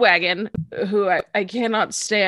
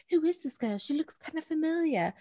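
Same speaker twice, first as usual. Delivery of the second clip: imitating JoJo; 5 semitones higher, 10 dB quieter than usual. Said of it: "and JoJo's like".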